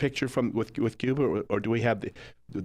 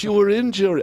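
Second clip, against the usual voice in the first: higher, louder eager insistence; 9 semitones higher, 7 dB louder than usual. Retold or imitated. [name] voiced in retold meeting.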